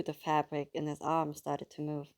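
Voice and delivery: usual speaking voice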